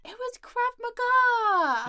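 slow, loud, high announcement; excited reveal. Real, not acted. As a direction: draw it out like a big reveal. Big reveal of activity.

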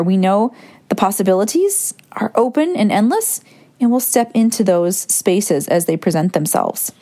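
ordinary talk

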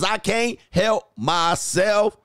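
slow, high, drawn-out protest; hammed-up helpless plea; mock helpless. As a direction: stretch each word as if losing the struggle. Acting out someone resisting temptation.

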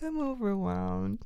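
small, soft, slow mock-tearful admission; mock pitiful. Wife answers husband's gentle question, mock pitiful. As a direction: imitate a weepy, worn-out confession. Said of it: "most of the arc of weeping".

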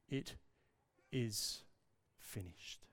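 very soft, low, broken by pauses; final breath. Retold or imitated. Jesus says it with his final breath, fading away. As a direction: let each word fade to an unvoiced end. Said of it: "with his final breath".

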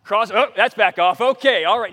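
loud alarmed outburst as water gushes; startled yell at the gushing water; panicked shout. Retold or imitated. Realizes water flooding, panic.

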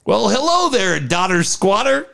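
put-on high, sing-song old man hello; high mock old man calling out; old man greeting. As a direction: greet him like a cheerful old-timer.

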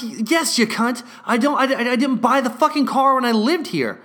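irritated retort. Retold or imitated. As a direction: snap back at the clerk, fed up.

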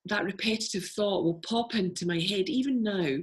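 ordinary talk, nothing marked

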